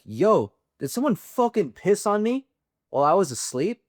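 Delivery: high-pitched, shocked yell down the aisle; yelling across the aisle. Retold or imitated. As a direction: call out high and outraged to the whole room. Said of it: "I'm, like, yelling".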